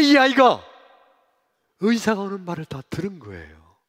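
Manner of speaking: loud, high impassioned preaching; forceful preaching. Acted. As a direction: drive the story home to the crowd. Preacher builds to full force. (열정적으로 외치는 설교)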